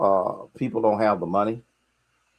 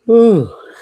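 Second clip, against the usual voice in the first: loud, high yawn; 15 dB louder than usual. Real, not acted. Livestream host caught yawning mid-talk, real yawn. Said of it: "I'm just yawning".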